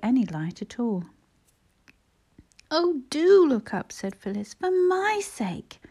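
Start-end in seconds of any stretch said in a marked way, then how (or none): 2.7-3.9 high, loud, drawn-out plea; earnest pleading. A narrator's reading. Girl begging the fainted boy to wake, pleading. 4.5-5.8 very high, drawn-out entreaty; implore, stretching it out high; plaintive pleading. A narrator's reading. Girl begging the fainted boy to come round, plaintive pleading.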